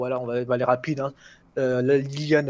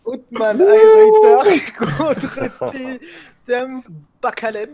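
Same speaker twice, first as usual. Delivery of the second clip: higher, slow, drawn-out fight introduction; annonceur de combat; 9 semitones higher, 9 dB louder, much slower than usual. Pretend. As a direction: announce the fighters to a roaring crowd, stretching the names. (annonceur de MMA survolté, lent et aigu)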